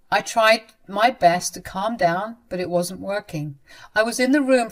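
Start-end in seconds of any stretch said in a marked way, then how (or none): none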